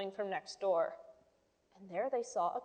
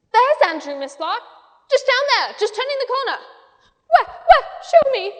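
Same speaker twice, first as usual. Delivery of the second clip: excited calling; 12 semitones higher, 13 dB louder than usual. A narrator's reading. Michael calls, Miss Lark replies.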